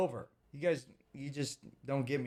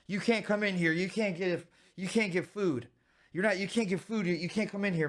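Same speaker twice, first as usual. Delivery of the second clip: mock frantic staff; 5 semitones higher than usual. Mocking staff refusing entry.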